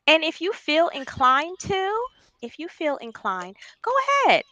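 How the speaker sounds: ordinary talk, nothing marked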